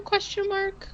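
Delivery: high, rising, unsure lilt; tentative high-pitched query; doubtful questioning. Real, not acted. Punctuation spoken as a doubting question.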